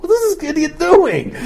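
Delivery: high incredulous scoff, laughing; laughing mock Goliath voice; baffled giant. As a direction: scoff in disbelief at the charging boy. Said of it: "Goliath is like".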